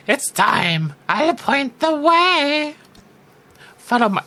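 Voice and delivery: high, very slow gremlin croak; evil gremlin. Acted. Player turning the kind lighthouse keeper into an evil gremlin croak. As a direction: drag it out like a wicked little gremlin. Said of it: "an evil gremlin".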